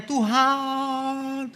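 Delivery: high, slow, drawn-out cry of Tuhan Maha Besar; mock loud praying. Preacher mocking showy worshippers with a drawn-out cry. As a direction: ham up a showy worshipper calling out. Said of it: "teriak-teriak gitu".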